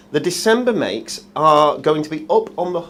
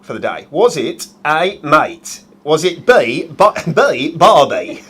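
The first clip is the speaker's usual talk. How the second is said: hammed-up Aussie quizmaster; Australian accent; 4 dB louder, slower than usual. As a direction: read out options in mock Australian. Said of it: "he's gonna try and be Australian".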